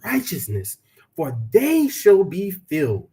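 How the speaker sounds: slow, solemn preacher's reading; scripture recitation. Acted. Reciting the Beatitudes to his viewers, slow solemn reading. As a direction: intone each blessing, lingering.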